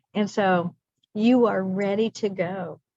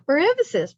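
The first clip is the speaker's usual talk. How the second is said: EMPHASIS punched louder and higher; emphatic repeat; 8 semitones higher, 5 dB louder than usual. Real, not acted. Teacher repeats herself out of family habit, emphatic. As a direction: say "for emphasis" with a big high push on EMPHASIS. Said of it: "twice and the second time louder".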